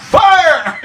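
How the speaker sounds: sudden high shout; ghost yelling fire. Retold or imitated. Ghost in his face, yelling fire. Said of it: "Uh-huh".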